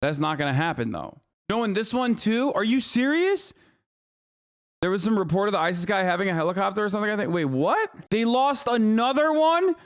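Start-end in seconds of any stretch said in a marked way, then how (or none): 1.5-3.5 can't believe what you're reading; stunned, high disbelief. Real, not acted. Disbelief at lost helicopter. 7.3-9.8 utterly amazed it happened again; incredulous high cry; astonished. Real, not acted. Second helicopter loss reaction.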